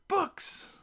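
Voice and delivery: very high-pitched gleeful cry; excited shout. A narrator's reading. Twin girls answer the gnome together, excited shout. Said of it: "they shout in unison".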